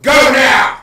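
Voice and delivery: huge, slow, high yell to make the dog leave; shouting at the dog. Real, not acted. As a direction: bellow the command to get out. Shouting commands at the dogs.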